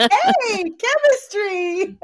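high-pitched, drawn-out exclamation; playful high-voiced mock delight; mock excitement. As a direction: sing it out high, stretching the last word. Acting out instant attraction in retelling.

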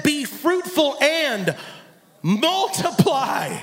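be fruitful and multiply drawn out very slowly; solemn, deliberate quote; slow emphatic scripture. Real, not acted. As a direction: lean on every word of be fruitful and multiply.

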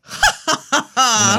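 high hammed-up mock laughter; fake courtly laugh. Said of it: "And everybody's like".

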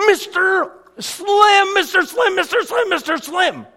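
high, loud desperate calls of a name; shouting for help. Retold or imitated. Boy yelling for the neighbor to save him, loud and high. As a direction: yell the neighbor's name for rescue. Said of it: "And I shouted".

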